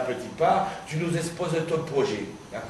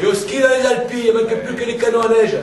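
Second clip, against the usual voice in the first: much higher and louder, laughing as he rattles it off; excited mocking outburst; 10 semitones higher, 7 dB louder, faster than usual. Old friend to returning comrade, mocking ski resorts, loud and laughing. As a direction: whip it up into a laughing rant.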